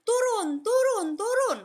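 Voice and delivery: high, loud shouts of get down; urgent crowd shouting turun; people yelling. Retold or imitated. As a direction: yell down at her from behind. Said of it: "Di belakang ini teriak-teriak".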